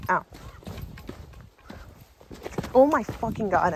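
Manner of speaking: usual speaking voice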